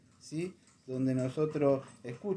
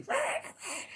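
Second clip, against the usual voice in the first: imitando al exorcista; 5 dB quieter, faster than usual. Retold or imitated. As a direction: mimic a strained, high-pitched exorcism cry. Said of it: "pero él lo decía así". (chillido agudo imitando al exorcista)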